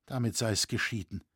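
usual speaking voice

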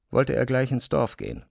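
normal talking voice

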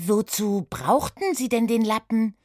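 hohe, neugierige Mädchenstimme; Oda, neugierig. Acted. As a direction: frag wie eine kleine Detektivin. Oda fragt. (Oda befragt die Putzfrau, hoch und neugierig)

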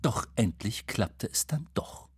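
ordinary delivery, nothing marked

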